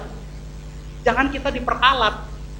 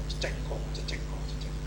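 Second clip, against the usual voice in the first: whispered, very soft repeated sound; whispered mimicry; 14 dB quieter than usual. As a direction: whisper it over and over. Mimics the dollar bills, one by one.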